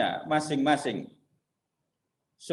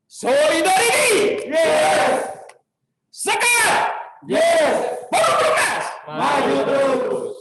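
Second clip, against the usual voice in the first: loud gung-ho soldier; 12 semitones higher, 5 dB louder, slower than usual. Real, not acted. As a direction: rally chant shouted at full voice. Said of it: "ini kan yel-yel Perumnas".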